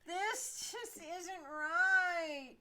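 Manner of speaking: whiny high-pitched protest; whining. Acted. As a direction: drag the complaint out like a spoiled actress. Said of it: "whines the actress".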